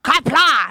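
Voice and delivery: "Qapla'" barked in mock Klingon; Klingon word. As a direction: shout "Qapla'" like a Klingon cheer. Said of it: "if Lucas would get up and he's like".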